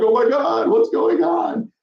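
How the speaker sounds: high frantic mock panic; hammed-up panicked shriek; panicked insurers. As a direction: squeal it like scared insurers. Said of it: "they get scared".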